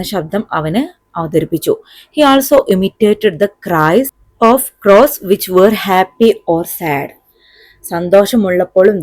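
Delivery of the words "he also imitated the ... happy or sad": slow, deliberate English reading; measured classroom dictation pace; textbook read-aloud. A narrator's reading. Reads textbook passage aloud.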